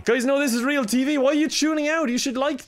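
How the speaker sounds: high-pitched, incredulous protest; exasperated pleading. Real, not acted. Player to viewers tuning out of real TV, exasperated pleading. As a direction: plead with a crowd that is walking out.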